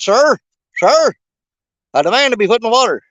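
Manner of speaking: high, loud, fast indignant rod voice; a rod losing patience, fast and loud; irate demand. Pretend. Fishing rod Freddie insists on being thrown in the water, loud and indignant. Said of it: "when little Freddie gets that irate".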